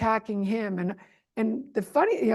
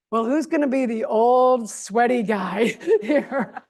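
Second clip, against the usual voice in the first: laughing mockery; 2 semitones higher, 6 dB louder, slower than usual. Real, not acted. Mocking Trump, laughing.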